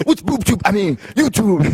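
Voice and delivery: high put-on voice, laughing; mock Scotty. Guest mocks Scotty mixing up words, high and hammy. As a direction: ham up the muddled old wrestler.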